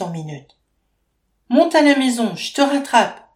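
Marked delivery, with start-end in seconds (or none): 1.5-3.2 high, loud order to hurry; panicked command, much louder; urgent shout. A narrator's reading. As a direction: call out in alarm to the grandmother. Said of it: "lance Faustine".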